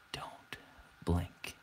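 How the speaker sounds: breathy mock hypnotist whisper; hushed playful whisper. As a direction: whisper the command right into their ear.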